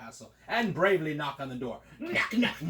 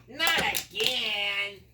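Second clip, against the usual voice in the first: high, very slow, fed-up wizard; exasperated groan; 6 semitones higher, much slower than usual. Acted. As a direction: drag it out, sick of princes. Weary wizard opens door.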